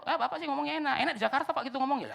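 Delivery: high-pitched mock complaint; mimicked complainer. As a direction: imitate a listener protesting to the pastor. Voices complaining Manado listeners.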